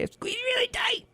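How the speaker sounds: squeaky squeeze. Retold or imitated. Fran bragging about her workout to Freddy, squeal. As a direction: squeak the word up high. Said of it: "Oh, Mister Freddy".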